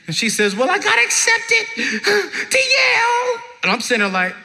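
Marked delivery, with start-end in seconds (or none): 0.6-3.4 very high, drawn-out mock bawling; hammed-up crying girl; tearful student. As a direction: wail the news through fake sobs. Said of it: "and she's crying".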